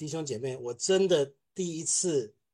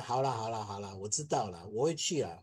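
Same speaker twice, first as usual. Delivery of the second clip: softer, lower, settled "ok, ok"; answering the scolding boss softly and peacefully; calm reply; 6 semitones lower, 5 dB quieter than usual. Retold or imitated. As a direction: calmly reassure the angry boss. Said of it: "心很平静".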